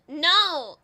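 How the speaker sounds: emphatic loud denial. Real, not acted. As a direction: shout down the idea. Denying the bull clip.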